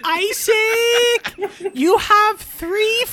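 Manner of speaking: high squeaky yell; shouting DVD voice. Acted. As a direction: shriek his name. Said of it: "I'm Amelie".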